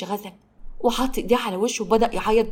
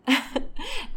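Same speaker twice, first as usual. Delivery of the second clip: short, higher-pitched sneering laugh; mocking laugh; 8 semitones higher, 5 dB quieter than usual. A narrator's reading. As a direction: laugh in mockery at her. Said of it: "وضحكت بتريقه". (ضحكة تريقة)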